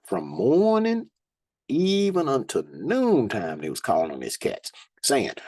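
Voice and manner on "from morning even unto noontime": raised, slow preaching read; scripture reading. Acted. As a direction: lift the verses up like a preacher. Reads scripture aloud.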